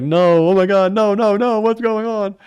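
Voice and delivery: high, loud mock panic, voice leaping up; hammed-up disbelief as the lead is lost; panicked cry. Gambler reliving the interception that lost his bet, in high, loud mock panic.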